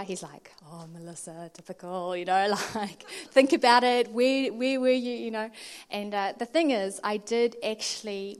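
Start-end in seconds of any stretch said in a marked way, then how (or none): 0.7-2.2 low, knowing sigh of a reply; mock resigned husband; lightly voiced husband. Husband's teasing reply on phone. 3.3-5.1 louder, insistent prompting; mock-insistent husband; lightly voiced husband. Imitating husband prompting her on phone.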